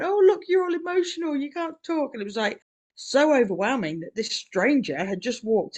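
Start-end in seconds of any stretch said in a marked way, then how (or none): 0.0-2.1 high, gushing voice of an excited friend; a high, doting friend teasing her tongue-tied mate. Retold or imitated. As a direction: coo it like an overjoyed old friend.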